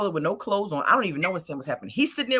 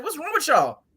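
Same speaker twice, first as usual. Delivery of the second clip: scolding; 6 semitones higher than usual. Retold or imitated. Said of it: "I'm in the middle like".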